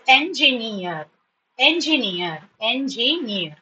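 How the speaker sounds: "engineer" slowly split as en-ji-neer; teacher breaking "engineer" into syllables; syllabified. Shown as a demonstration. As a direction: repeat "engineer" in three measured beats. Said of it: "how you have to learn syllabification".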